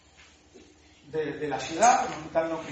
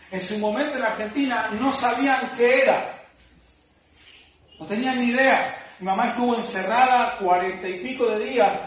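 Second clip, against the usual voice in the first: fervent preaching; 3 semitones higher, 5 dB louder than usual. Acted. Preaching builds in force.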